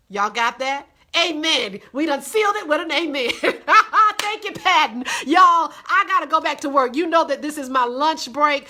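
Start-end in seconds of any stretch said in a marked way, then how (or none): 3.4-4.2 high, loud laugh; delighted laugh. Real, not acted. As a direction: laugh out loud after the amen. Laughs after the amen.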